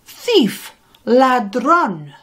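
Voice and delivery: high sharp shouts after a thief; shouting. A narrator's reading. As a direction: yell it out as he chases.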